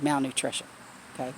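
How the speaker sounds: ordinary delivery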